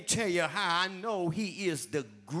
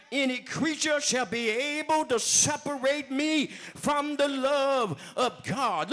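higher, ringing preacher recitation of scripture; preached scripture; 8 semitones higher than usual. Acted. Preacher quoting Romans to the church, ringing and preached. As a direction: build each nor like a rising chant.

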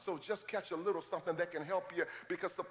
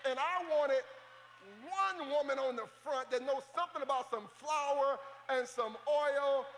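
preaching at full force; 6 semitones higher than usual. Acted. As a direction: preach it out to the congregation.

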